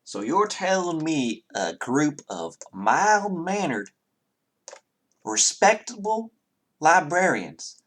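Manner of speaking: slow, high Southern drawl in disbelief; Southern character, incredulous. Acted. Townsman doubting the party about librarians, incredulous Southern drawl.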